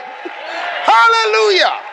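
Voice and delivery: high, long-drawn hallelujah shout; soaring, stretched preacher's shout; shouted praise. Real, not acted. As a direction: cry it out to rouse the congregation. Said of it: "shout a little bit".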